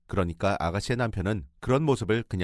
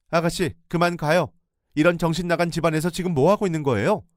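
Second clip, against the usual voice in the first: angry urging; 8 semitones higher, 6 dB louder than usual. Retold or imitated. Pulling her out angrily.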